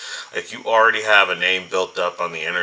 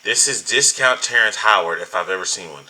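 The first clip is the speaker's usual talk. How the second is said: ordinary talk, nothing marked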